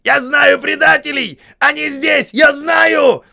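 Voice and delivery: отчаянный крик, высоко и громко; вопит на балкон султана; вопль. A narrator's reading. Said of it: "возопил". (отчаянный вопль)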